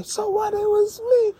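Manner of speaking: high whiny "so what"; squeaky, crying-edged brag; mock defiance. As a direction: whine it like a caught kid. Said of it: "gonna say".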